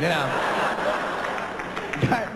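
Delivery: ordinary delivery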